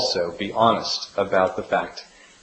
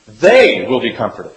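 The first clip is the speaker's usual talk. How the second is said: "They" stressed, louder, start of the line; emphatic they; 2 semitones lower, 5 dB louder than usual. Shown as a demonstration. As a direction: lean on "They will be comforted". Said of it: "is emphatic, which reads".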